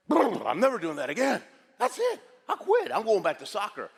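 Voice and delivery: high, loud outburst of wanting out; hammed-up frantic quitting, voice shooting up; mock panic. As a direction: blurt it loud and high, badly rattled. Acts panicked self after the run.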